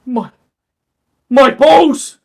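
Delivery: very high, very loud pained yell. Acted. Cursed thug screams in pain, very high and loud. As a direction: scream it as the spell hits. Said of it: "You can hear this guy yell".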